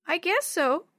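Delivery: put-on high, reluctant little kid; high-pitched grudging child. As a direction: agree half-heartedly in a small child's voice. Young Yo-Yo reluctantly agrees.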